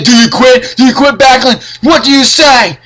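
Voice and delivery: a mild impression of a heckling referee; mock Piper badgering. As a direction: jam the mic in his face and needle him. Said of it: "Piper would come out with the microphone and say".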